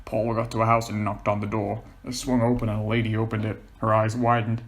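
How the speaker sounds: usual speaking voice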